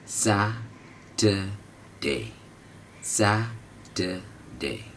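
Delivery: Saturday drawn out very slowly with सैट lifted; stress on SAT. Shown as a demonstration. Teacher drills students on stressing सैट in Saturday. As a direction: stretch it slowly and punch सैट. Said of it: "इसमें पहला उठेगा ये वाला".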